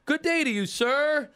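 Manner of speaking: high, hearty mock welcome; friendly neighborhood racketeer. Stranger welcomes the newcomer to the neighborhood, hammed-up high greeting. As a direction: lay on a high-pitched, over-friendly greeting. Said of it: "So the guy goes".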